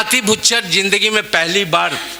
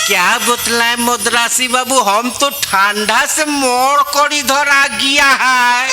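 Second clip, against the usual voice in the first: put-on Bengali accent in Hindi; 4 semitones higher than usual. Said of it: "मैं दोनों का अंदाज आपके बीच पेश कर रहा हूँ".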